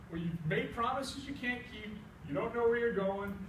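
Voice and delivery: plain reading voice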